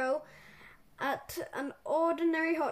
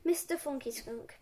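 usual speaking voice

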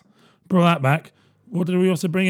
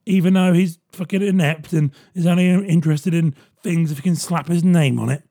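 ordinary talk

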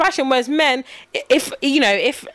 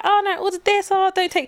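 higher-pitched chatty re-enactment; acted ordering chatter; 8 semitones higher than usual. Host acts out a couple ordering at McDonald's, high chatty mimicry. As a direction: play the eager date at the touch screen, higher. Acting out a date ordering food.

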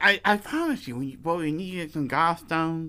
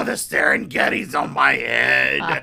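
loud, low moaning complaint; dramatic sick man; 5 semitones lower, 4 dB louder than usual. Retold or imitated. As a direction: groan about the illness. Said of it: "Willie gets sick".

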